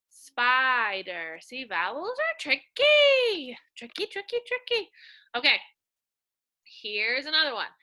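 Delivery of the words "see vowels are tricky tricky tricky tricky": playful sing-song. Real, not acted. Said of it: "sing-song teasing".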